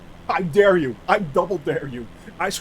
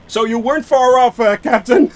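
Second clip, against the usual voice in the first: usual speaking voice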